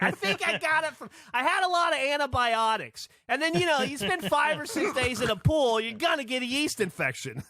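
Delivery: high put-on lady voice rattling on; chatty oversharer. To the pool crowd, a high mock chatty explanation. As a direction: explain it cheerfully to strangers. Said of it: "She's like".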